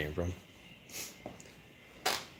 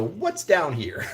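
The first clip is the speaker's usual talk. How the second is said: slow curious; 8 semitones higher, much slower than usual. Pretend. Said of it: "you go".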